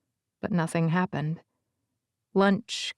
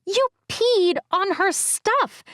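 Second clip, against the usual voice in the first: put-on much higher girl's voice, slow and accusing; girl scolding her brother; high, slow reproachful girl's voice; 12 semitones higher, slower than usual. A narrator's reading. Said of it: "Bee said".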